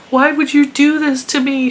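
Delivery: mock sobbing. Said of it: "she cried hysterically".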